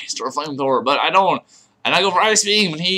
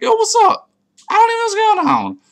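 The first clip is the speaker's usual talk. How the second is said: squeaky, much higher put-on voice; hammed-up high voice; 5 dB louder than usual. Player retelling confusion mid-match, high put-on squeal. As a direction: push it way up high, mock clueless. Retold confused reaction to game.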